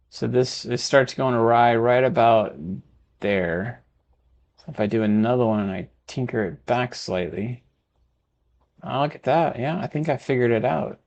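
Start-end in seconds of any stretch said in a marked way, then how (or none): none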